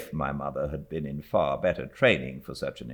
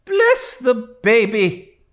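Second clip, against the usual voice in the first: slow, loud, higher exclamation; exclaiming; 12 dB louder, slower than usual. A narrator's reading. Stern aunt exclaiming to the widow, loud slow. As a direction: burst out with it. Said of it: "exclaimed Miss Betsy".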